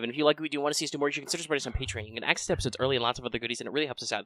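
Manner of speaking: fast, fading Patreon plug; sped-up read. Real, not acted. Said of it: "You sped up at the end".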